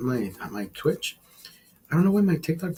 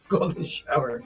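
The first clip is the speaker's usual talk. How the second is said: softer, slower, laughing over Golden shower; amused slow murmur; chuckling repeat; 6 dB quieter than usual. Real, not acted. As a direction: relish the joke quietly. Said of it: "Oh, golden shower".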